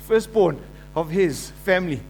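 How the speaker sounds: ordinary delivery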